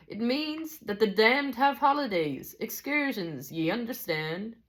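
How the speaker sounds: slow, high Scottish voice; Scottish teacher, high and deliberate; attempted Scottish accent. A narrator's reading. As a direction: draw it out in amateur Scots. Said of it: "I'm not good at a Scottish accent".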